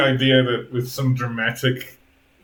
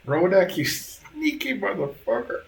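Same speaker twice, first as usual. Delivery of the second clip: acted exasperation; 8 semitones higher than usual. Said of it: "looks around the room and goes".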